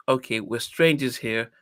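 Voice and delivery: ordinary delivery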